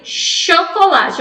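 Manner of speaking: CHOCOLATE said "sho-ko-la-tchi"; Portuguese chiado. Shown as a demonstration. Teacher showing her own chiado on the first word, slow. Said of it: "Olha aí o chiado que eu tenho".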